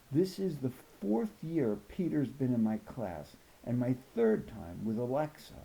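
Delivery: plain reading voice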